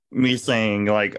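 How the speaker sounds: usual speaking voice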